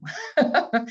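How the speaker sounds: a high, choked laugh through welling tears; emotional laugh. Real, not acted. Guest overcome by her own story, laughing through tears. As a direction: laugh it off as the feeling catches you. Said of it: "now I'm getting emotional".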